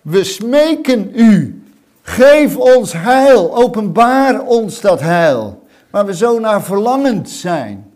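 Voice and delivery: loud, high and slow imploring; impassioned shouted plea; crying out in prayer. Acted. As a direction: beg God at full voice, slowly. Pleading prayer cried out again.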